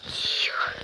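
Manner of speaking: hushed whisper while hiding. Real, not acted. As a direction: breathe the word out, no voice. Hiding from zombies, says quiet. (шёпотом)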